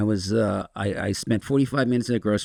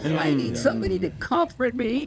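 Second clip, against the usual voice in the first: needy plea climbing ever higher; hammed-up neediness; 6 semitones higher than usual. Addict explaining why he called his wife, mock-needy. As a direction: beg in a put-on, high pleading voice.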